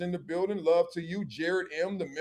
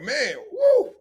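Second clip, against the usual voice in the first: high "woo" and "ooh" after a strong shot; imitating a player reeling from a strong shot; shot aftermath; 6 semitones higher, 3 dB louder than usual. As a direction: gasp through the burn of the liquor. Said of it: "You like".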